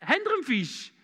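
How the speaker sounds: calling out. Retold or imitated. A stranger calls to fishermen from the shore, cheeky calling out. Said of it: "wer es ist, und ruft".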